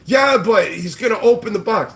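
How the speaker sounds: high, loud whiny mock reply; mocking whiny flipper. Streamer mimics the flipper's comeback, high whiny mock. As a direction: whine it like a sulking kid.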